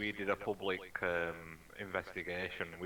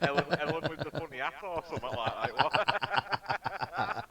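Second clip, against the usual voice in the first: talking through a real laugh, high and slow; amused, laughing while telling it; 7 semitones higher, 4 dB louder than usual. Real, not acted. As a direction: giggle the words out slowly. Retelling funny spirit box message.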